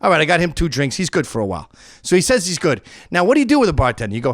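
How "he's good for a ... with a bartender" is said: ordinary talk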